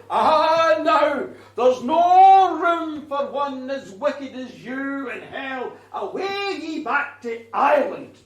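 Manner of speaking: the devil, loud and slow, scornful; mocking devil. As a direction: bellow her away from hell's gates. The devil turns her away.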